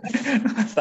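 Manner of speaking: short high chuckle; a light laugh at himself; amused laugh. Real, not acted. Laughing at being older.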